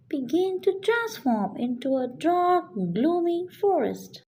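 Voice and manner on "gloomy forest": usual speaking voice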